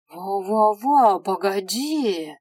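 drawn-out, loud stammering protest; alarmed stammer. Acted. Dead girl stopping the imp from sending her to hell, alarmed. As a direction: throw up your hands and stall him.

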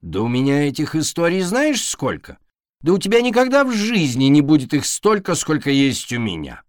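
indignant boasting. A narrator's reading.

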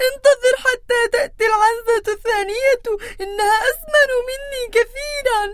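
high, fast, tearful begging; weak voice. Acted. Smallest goat begging the troll not to eat her, tearful and high. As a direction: plead like a frightened little goat. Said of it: "بصوت ضعيف".

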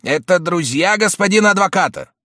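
high, loud demanding shout. A narrator's reading. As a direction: call it through the door. Uncle shouting at door.

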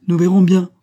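loud, high-pitched challenge; cold retort. A narrator's reading. Mother answering her daughter's threat, coldly defiant. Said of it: "répliqua froidement Marie".

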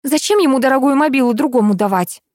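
high-pitched puzzled question; sincerely surprised. A narrator's reading. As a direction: wonder aloud in genuine puzzlement. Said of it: "искренне удивилась Лариса". (искренне удивлённо)

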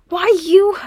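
high, loud indignant outburst. A narrator's reading. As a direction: puff up in offended protest. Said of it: "Gimli puffed himself up indignantly".

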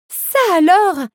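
high-pitched startled exclamation; alarmed cry. A narrator's reading. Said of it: "cria Ariel alarmée".

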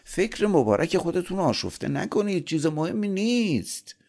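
high-pitched, oily sycophant; servile, sugary high-voiced reassurance; fawning flattery. A narrator's reading. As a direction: fawn over your master in a high voice. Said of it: "شیطان با چاپلوسی گفت".

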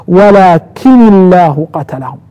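"ولكن" with single n linked by i, walakini-llahu; lightened reading. Shown as a demonstration. Teacher showing students the lightened reading, "ولكن الله" as walakini-llahu. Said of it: "ابن عامر والكسائي وحمزة قرأ".

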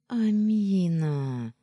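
indignant drawl. A narrator's reading. Mistress scolding the teasing maid, drawn-out indignation. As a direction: stretch the name in playful protest. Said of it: "возмущённо протянула я".

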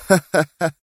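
high, short nervous laugh; tense guffaw. A narrator's reading. Yaron laughs off Sonia's insult, tensely. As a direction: force out a tense cackle. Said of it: "قهقه ضاحكا في توتر".

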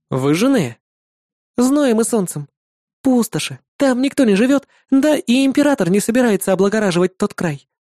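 put-on high bright girlish voice; young woman voice. A narrator's reading. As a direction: voice the demon girl cheerfully. Irda tells about the empire.

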